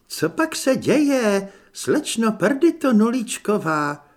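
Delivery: high, loud, drawn-out call; calling from afar. A narrator's reading. Walter answers Anežka from afar, calling out. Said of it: "zazněla jako zadunění hromu".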